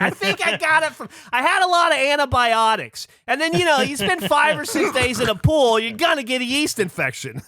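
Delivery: very high, hammed-up chatter; exaggerated high-pitched imitation of a loud lady; oversharing woman. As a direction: prattle on shamelessly in a high caricature voice. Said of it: "She's like".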